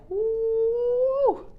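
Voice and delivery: long, high, loud whoo; impressed whoop. Acted. Reader showing the viewer their huge energy, high whoop. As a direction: hold a high, ringing whoop. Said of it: "your energy is like".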